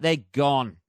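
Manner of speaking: normal talking voice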